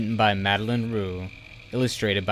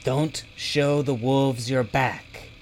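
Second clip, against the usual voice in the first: slow, higher-pitched mild yell; yelled warning; 4 semitones higher, slower than usual. A narrator's reading. Bobble warns friends from inside the bag, yelling. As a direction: call out a warning, drawn out. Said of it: "Bobble yelled from inside Hugo's bag".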